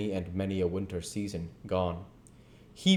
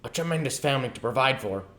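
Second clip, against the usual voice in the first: quick, raised grumble, hardly muttered; Scrooge muttering; 6 semitones higher, faster than usual. Acted. Scrooge remarks on the ghost's family, quick. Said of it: "muttered Scrooge".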